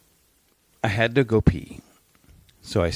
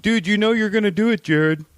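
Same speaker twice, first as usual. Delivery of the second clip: teasing coworker; 11 semitones higher than usual. Retold or imitated. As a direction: rib your buddy in a high ribbing tone. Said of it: "and goes".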